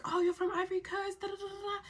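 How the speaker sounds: high-pitched mock chatter; mimicking the guy. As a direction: imitate him babbling on in a high voice. Mimics the guy's chatty reply.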